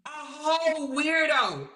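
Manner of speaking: slow, mocking "A whole weirdo."; slow disgusted drawl. Real, not acted. Calling the ex a weirdo, slow and disgusted. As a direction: drag it out in disgust.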